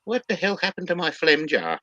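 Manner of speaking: put-on high old-lady voice, baffled; confused old lady. Old lady on the train wondering where her jar went, high and baffled. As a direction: squeak it out like a puzzled granny.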